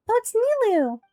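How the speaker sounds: light up as she walks in; excited surprise. Acted.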